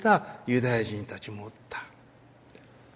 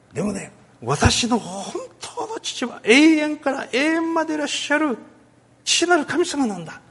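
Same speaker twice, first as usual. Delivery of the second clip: impassioned; 11 semitones higher, slower than usual. Retold or imitated. Preacher voicing Jesus's declaration.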